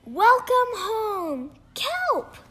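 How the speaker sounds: high, loud, slow cheer rising on the name; joyful welcome. Acted. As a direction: cheer him home. Friends welcome Kelp back.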